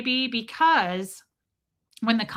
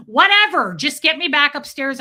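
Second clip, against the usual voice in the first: higher, much louder exasperated plea; desperate outburst; 5 semitones higher, 8 dB louder than usual. Retold or imitated. Panicked traveler begs the officer, loud outburst. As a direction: beg to be let out, loudly.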